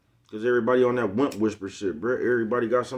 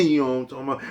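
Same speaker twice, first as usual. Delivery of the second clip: ordinary delivery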